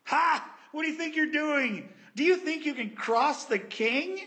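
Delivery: high, loud scoffing jeer; mocking taunt. Enemies jeering at the builders, mocking laugh. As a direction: sneer it at them like a mocker. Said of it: "they laughed at us, mocking us".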